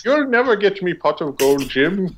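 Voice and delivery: high Irish leprechaun voice; squeaky stage-Irish leprechaun. Retold or imitated. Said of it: "He was like".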